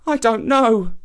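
lost and downcast; sad. A narrator's reading. Lost youth answers the ape, sad and forlorn. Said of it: "replied Mvula Ana sadly".

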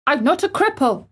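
high, indignant protest; ferocious cry. A narrator's reading. Boy furiously denies the gardener's words, ferocious. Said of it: "cried out ferociously".

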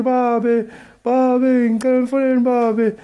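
pleading son. Retold or imitated. Caller voices son begging to phone father.

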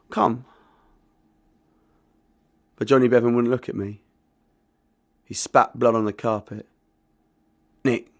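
measured spoken-word reading with acted voices; dramatic poem performance. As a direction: read the poem aloud like a live stage show. Performs his narrative poem.

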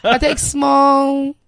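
slow, emphatic Nigerian Pidgin answer. Pretend. Asked about breakfast, answering in Nigerian Pidgin. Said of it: "can you answer in, like, Pidgin".

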